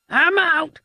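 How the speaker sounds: high-pitched cry of quitting; agitated outburst. A narrator's reading. Thug quitting on his gang, agitated outburst. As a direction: blurt it out, high and fed up. Frankie quits in a huff.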